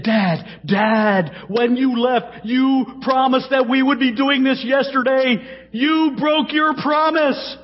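put-on high, childlike voice of a crying son; a father retelling his upset son's words in a high child's voice; upset little boy. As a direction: voice the hurt five-year-old. Preacher voicing crying five-year-old son.

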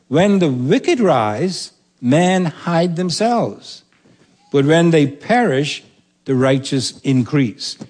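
slow, weighty recitation of the proverb; preacher reciting Proverbs to the congregation; solemn scripture reading. Acted. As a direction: read it slow like scripture from the pulpit. Reads proverb in solemn cadence.

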